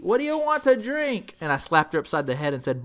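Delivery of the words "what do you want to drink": mock scream. Drunk passenger screaming from the back seat, mock high yell. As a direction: imitate a drunk yelling from behind. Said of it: "screaming in the back seat".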